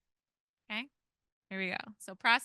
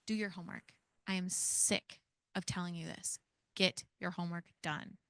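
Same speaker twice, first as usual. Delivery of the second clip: strong tone; 2 semitones lower, 9 dB quieter than usual. Shown as a demonstration. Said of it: "I am going to use strong tone".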